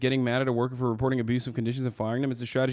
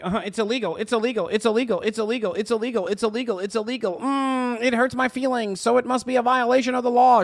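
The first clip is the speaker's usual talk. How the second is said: higher, nasal-free whiny parody of the pundit; mocking impression; 7 semitones higher, faster than usual. Streamer mocks a pundit repeating himself, higher whiny voice. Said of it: "he's just like".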